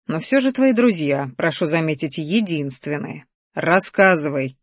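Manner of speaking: usual speaking voice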